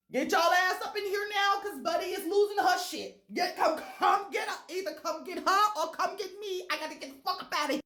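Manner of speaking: high, loud frantic call for help; frantic yelling. Retold or imitated. To the nurses on the call button, frantic high shouting. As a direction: yell for the nurses to come now.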